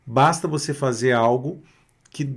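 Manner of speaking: ordinary delivery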